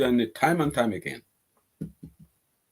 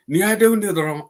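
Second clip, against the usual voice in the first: ordinary talk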